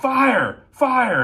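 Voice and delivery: high, urgent shout. Retold or imitated. Dispatcher yelling across the office to fire dispatch, shouting. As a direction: yell it out as an alarm. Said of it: "I, I shout".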